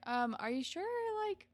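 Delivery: high, probing mock concern; nosy prying. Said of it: "gets super nosy".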